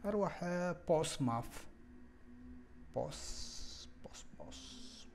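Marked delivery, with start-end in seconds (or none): 3.0-5.1 whispering to himself while clicking; breathy murmur under his breath; whispered muttering. Real, not acted. Tutor mutters to himself while placing points, whispering. Working alone, muttering to self.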